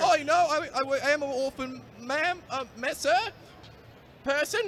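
high, put-on orphan voice in a bad accent; Victorian orphan. Groundskeeper stammering to the warden in a high orphan voice. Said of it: "Some sort of Victorian orphan?".

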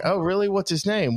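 high, surprised question. Retold or imitated. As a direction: act genuinely caught off guard. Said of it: "And I was like".

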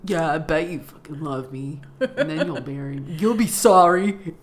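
a put-on low, spiteful guy's voice; mock spiteful dude. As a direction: sneer it under your breath. Mocking the spiteful proposer.

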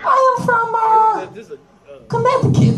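thick mock Russian accent, jumping very high on Connecticut; hammed-up Russian denying being Russian, squeaking up high. Comic teasing people who ask, in Russian accent. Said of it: "and I'd go".

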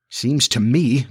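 ordinary delivery, nothing marked

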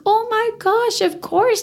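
high, gushing yes from the client; excited client voice. Retold or imitated. Client gushing high over the phone, delighted. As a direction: squeal it out like a delighted bride's mother. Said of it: "And she goes".

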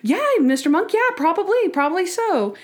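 high-pitched placating imitation; exaggerated, indulgent yes; humoring reply. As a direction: agree in a sing-high coaxing voice. Acts out women humoring Monk.

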